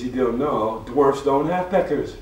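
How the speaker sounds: dwarf explaining to the man. Acted. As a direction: spell it out slowly, loud.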